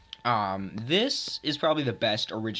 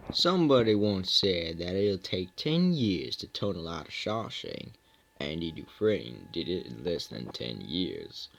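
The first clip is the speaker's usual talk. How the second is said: movie narrator impression; 5 semitones lower than usual. Imitates Shawshank film line.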